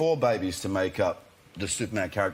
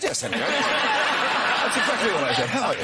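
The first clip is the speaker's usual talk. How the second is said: laughing; 5 dB louder than usual. Retold or imitated. Retold reply, laughing, to Henry.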